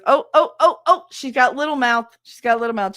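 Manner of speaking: high, loud singling-out tease; excited mocking "oh, oh, oh"; teasing son. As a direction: crow it gleefully at mom. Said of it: "He goes".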